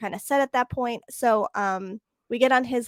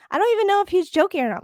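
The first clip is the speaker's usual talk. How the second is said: fast, higher and louder aside; acted-out bewilderment; 8 semitones higher, 5 dB louder, much faster than usual. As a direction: imitate her baffled rush of words. Quotes bewildered woman to her.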